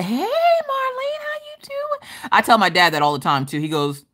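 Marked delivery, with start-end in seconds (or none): 0.0-2.1 mock sweet greeting. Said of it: "I'm like".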